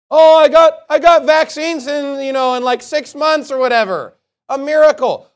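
loud, high sarcastic bragging; mocking boast. Preacher mocking Donald Trump bragging about vaccines, sarcastic boast. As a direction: crow about it in mock triumph.

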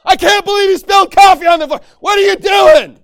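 furious high-pitched shouted tirade; blowing his top. Acted. Blowing up at someone over spilled coffee, furious screaming. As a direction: scream it at them in an outraged outburst. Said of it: "blowing your top at someone for no reason".

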